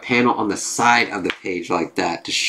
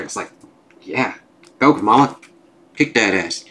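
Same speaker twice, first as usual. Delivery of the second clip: ordinary talk, nothing marked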